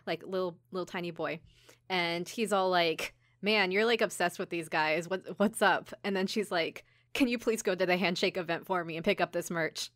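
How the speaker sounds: ordinary talk, nothing marked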